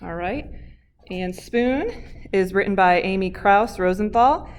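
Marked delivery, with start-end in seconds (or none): none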